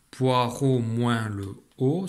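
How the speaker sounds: "Poireau" slowly, syllable by syllable; drawn-out, deliberate "Poireau moins le O"; sounding out. Shown as a demonstration. Teacher taking the last sound off a word, slow and measured. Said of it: "j'enlève le dernier son".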